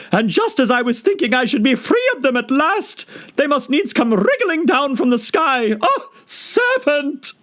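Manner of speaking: very high wailing bird, half laugh half cry; shrieking pigeon. Acted. Pigeon shrieking at Alice in despair. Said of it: "raising its voice to a shriek".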